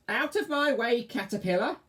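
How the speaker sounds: high, loud witch shriek. Acted. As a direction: screech it like a witch. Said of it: "shrieked the witch".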